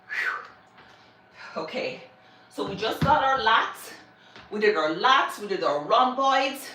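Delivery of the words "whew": breathless whew after a set; exhausted whew. Real, not acted. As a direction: blow out a tired whew. Exhale after finishing heavy set.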